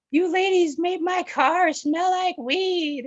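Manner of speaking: high, slow put-on scolding voice; mock angry cop. Cop scolding girls in her car, high mock annoyance. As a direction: ham up an irritated lady cop. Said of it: "she's all pissed off".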